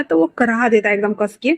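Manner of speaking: usual speaking voice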